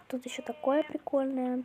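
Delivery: ordinary talk, nothing marked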